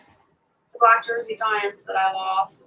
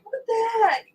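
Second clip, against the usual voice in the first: stunned what the heck; 12 semitones higher than usual. Retold or imitated. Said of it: "All three of us were like".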